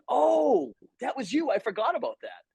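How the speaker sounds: high, loud drawn-out "oh" of recognition; surprised realization. Acted. Host imitating people reminded of a role, surprised. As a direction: act out someone suddenly realizing.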